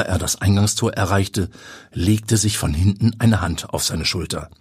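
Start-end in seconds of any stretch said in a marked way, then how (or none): none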